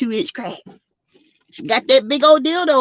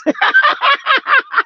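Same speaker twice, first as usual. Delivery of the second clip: squealing high laugh; 3 dB louder than usual. Real, not acted. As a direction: let out a high giggle. Laughing after mama's quote.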